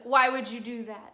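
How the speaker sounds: loud plea of disbelief; loud dismayed question. Acted. Nemorino protesting to Adina, loud. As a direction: demand it out loud. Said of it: "he's like".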